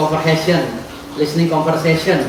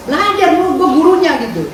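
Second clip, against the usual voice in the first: higher, louder boasting jab; loud taunt; 10 semitones higher, 3 dB louder than usual. Real, not acted. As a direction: crow it at him, much higher. (ejekan keras menantang Hotman)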